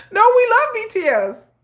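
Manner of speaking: high, chirpy forced cheer; mock eager fan. Critics forced to praise BTS, squeaky fake cheer. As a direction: squeak it out like a scared fan faking joy. Fans forced to act happy.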